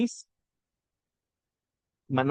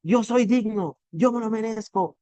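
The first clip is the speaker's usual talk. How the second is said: raised, higher mock shout of self-belief; retold shout; 6 semitones higher than usual. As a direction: shout it like a pumped-up CEO closing a training. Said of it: "y empieza a gritar". (imitando un grito de confianza, más agudo)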